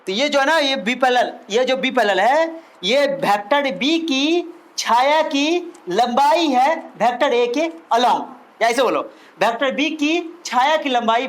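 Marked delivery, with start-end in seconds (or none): 2.8-8.2 very slow, word by word for notes; slow dictation. Shown as a demonstration. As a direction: spell it out so students can write. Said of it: "spelling out the definition slowly".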